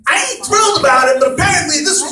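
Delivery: mock outburst at a nagging customer; exasperated rant. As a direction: vent it like a plumber who has finally had enough. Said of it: "I just wanna turn around and show my ass and say".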